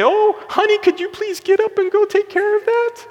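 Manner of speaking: timid husband pleading with his wife to deal with the intruder; timid plea. Retold or imitated. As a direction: beg your wife to handle it.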